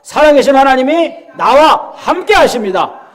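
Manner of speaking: loud, high, measured declaration of faith; bold proclamation. Shown as a demonstration. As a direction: lead a congregation in proclaiming. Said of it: "우리 선포합시다". (힘차게 선포하는 목소리)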